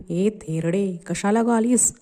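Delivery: usual speaking voice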